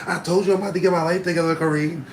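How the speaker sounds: high, loud and fast; hammed-up angry girlfriend; fed-up girlfriend. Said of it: "she's like".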